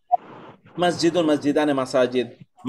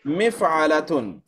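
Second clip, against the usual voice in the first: usual speaking voice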